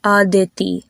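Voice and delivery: Indian-style "Aditi", tongue behind the front teeth; Indian pronunciation. Shown as a demonstration. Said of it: "lighter with the Indian pronunciation".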